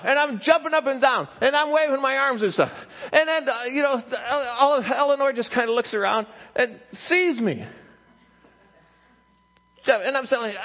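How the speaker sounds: worked-up storytelling at high pitch; excited, high-pitched sermon anecdote; animated preaching. Real, not acted.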